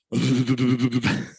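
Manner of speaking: long fluttering motorboat noise; imitated belly-raspberry noise. Host demonstrates what he did on his dog's chest, sputtering noise. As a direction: sputter a rapid, steady brrr. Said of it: "put my hand there and went".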